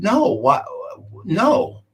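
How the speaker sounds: high-pitched puzzled denial; taken aback. Retold or imitated. Retold reply to alarming medical question.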